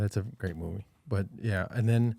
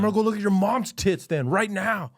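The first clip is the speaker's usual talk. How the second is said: mock threat; 10 semitones higher, 3 dB louder than usual.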